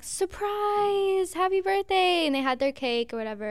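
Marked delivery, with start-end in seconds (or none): 0.0-2.3 pitched up imitating the family's cheer; mock surprise party cheer. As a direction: sing out surprise like the parents did. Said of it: "it was, like".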